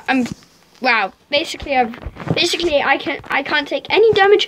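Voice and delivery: ordinary talk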